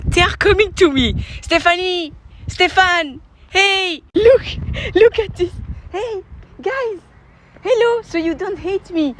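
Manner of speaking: high, affectionate animal-greeting voice; calling to animals. Real, not acted. Him calling swans Stephanie and Stefan over, high and affectionate. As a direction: call them over in a high, loving voice.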